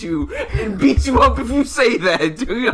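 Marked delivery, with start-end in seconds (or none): none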